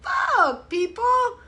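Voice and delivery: a high, crying shout at people; shouted disbelief. Retold or imitated. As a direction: yell it in disbelief. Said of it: "I'm like".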